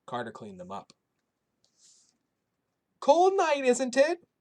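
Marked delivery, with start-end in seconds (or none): 3.0-4.3 put-on high, loud old Polish woman's accent; kindly old woman with a thick Polish accent. A narrator's reading. Shelter cook greeting the boy in a thick Polish accent. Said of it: "in her thick Polish accent".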